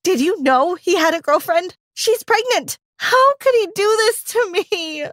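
high, distraught voice near tears; crying. Acted. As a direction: wail it down the phone, heartbroken. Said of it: "I cried".

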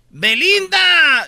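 high, long drawn-out jeer; mock crowd heckle. Angry fans taunt a late singer, high drawn-out heckle. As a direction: stretch the name out like a heckler. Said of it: "Le gritaban".

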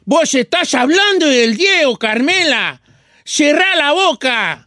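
mock Argentine scolding with drawn-out stresses; hammed-up Argentine sing-song, slowing at the end; Argentine accent. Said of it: "¿Con ese acento?".

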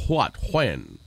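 "what, when" opened with an h, like "hwat, hwen"; low-pitched "what, when" with aspirated W; breathy WH sound. Shown as a demonstration. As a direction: put an H before the W in "what, when". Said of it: "sound a las palabras que empiezan con W".

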